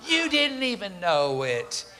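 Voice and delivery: "You didn't even know it" drawn out slowly; slow emphatic repeat. Real, not acted. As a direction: stretch each word for weight.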